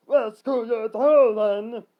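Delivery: long drawn-out high yell. Acted. Cutter challenging his cousins, yelling. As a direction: yell it slowly at full volume. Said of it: "Cutter yelled".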